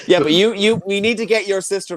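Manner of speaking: ordinary delivery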